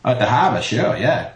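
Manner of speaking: put-on Boston vowels, dropped r's; fake Boston accent. Actor faking it at an audition, broad Boston accent. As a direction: bluff your way through a Boston accent. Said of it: "Can you speak Boston?".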